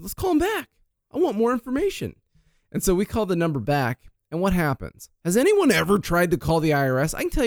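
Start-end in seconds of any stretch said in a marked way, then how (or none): 0.0-2.1 eager. Retold or imitated. Eager quote, big pitch jump.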